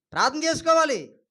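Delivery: firm, ringing instruction; emphatic exhortation. Real, not acted. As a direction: call it out as a command. Answers own question, then repeats softly.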